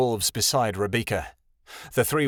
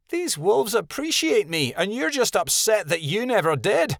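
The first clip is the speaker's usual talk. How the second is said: higher, mild read-aloud snap; lightly snapped retort; 11 semitones higher than usual. A narrator's reading. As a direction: snap back at her brother. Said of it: "Rebecca snapped".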